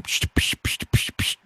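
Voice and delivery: beatbox hi-hats; mouth drum beat. Pretend.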